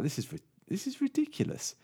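high-pitched mock disbelief; squeaky, baffled protest; incredulous boss. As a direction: splutter in acted astonishment.

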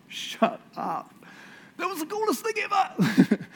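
high, loud, thrilled exclamation; amazed squeal. Pretend. Believer amazed their prayer worked, squealing high. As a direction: squeal it like you can't believe it. Mimics someone amazed after helping.

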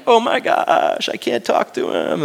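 high, drawn-out mock gasping awe; overawed fan. Fan too awed to approach his idol, starstruck awe. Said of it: "there was a sense of awe".

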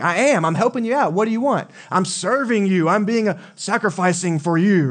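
high, pushed complaining whine; mock self-righteous huff; frustrated complaining. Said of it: "I'm like".

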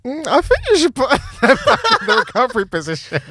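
high, quick mock advice through laughter; laughing retelling. Friends advising the man shaking someone, retold laughing. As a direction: imitate tentative friends, breaking up laughing.

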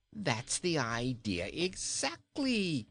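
slow, hearty king voice; jolly king. A narrator's reading. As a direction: declare it like a merry king. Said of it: "declared Rinkitink".

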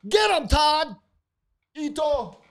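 high, loud rallying yell; mock battle cry. Cocky drummer yelling at teammates to charge, high and loud. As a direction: cheer them into the fight, loud and high.

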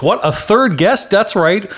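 high, hyped-up ring announcer; mock-grand guest introduction. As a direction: introduce a superhero like a showman. Hypes the next guest.

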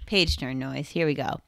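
ordinary talk

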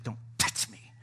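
harsh whisper, imitating wife in labor; whispered warning. Laboring wife to husband rubbing her, whispered warning. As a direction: whisper it as a mock threat.

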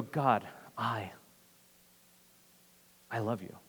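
soft, low, halting admission; honest confession. Acted. Worshipper honest enough to answer God, soft and halting. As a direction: confess it quietly, pausing before the words. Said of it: "will we be honest enough".

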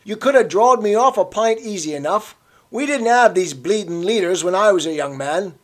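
put-on an old man refusing to let it go; stubborn old Cockney. A narrator's reading. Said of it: "persisted the old man".

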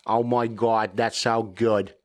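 put-on amateur Boston accent; Bostonian accent. Podcaster playing at being American, Bostonian accent. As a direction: try on a Boston voice. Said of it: "how good my Bostonian accent is".

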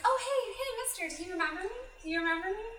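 high-pitched imitation of an eager girl; mock-eager character voice; eager girl greeting. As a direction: act out a hopeful hello, pitched well up.

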